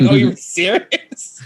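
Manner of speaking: slow, incredulous disbelief; drawn-out stunned question; slow surprised Southern twang. Retold or imitated. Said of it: "she was like".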